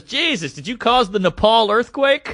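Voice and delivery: high, mock-appalled jab; cutting him down. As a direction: imitate a comic roasting a heckler. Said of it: "She's just cutting the guy down".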